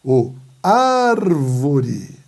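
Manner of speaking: loud call with ÁR in "árvore" held very long; stretched stressed syllable. Shown as a demonstration. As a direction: bellow "árvore", dragging out the first syllable. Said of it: "Proparoxítonas".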